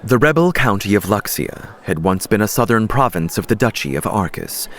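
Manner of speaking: ordinary delivery, nothing marked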